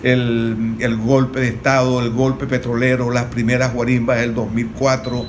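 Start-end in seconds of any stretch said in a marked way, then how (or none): none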